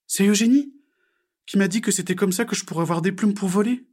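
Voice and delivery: high, unhappy little frog voice; sad reply. A narrator's reading. Frog explains to Diego, high sad voice. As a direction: answer high and sorry, as a small frog. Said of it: "répondit Pigouille tristement".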